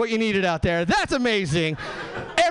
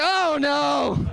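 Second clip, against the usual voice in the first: horrified oh no; 5 semitones higher than usual. Real, not acted. Horrified at the app's use.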